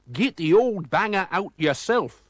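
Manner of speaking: high, loud, slow taunt; mock him nastily; jeering. A narrator's reading. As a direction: crook taunting the stranded driver. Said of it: "jeered McClurk".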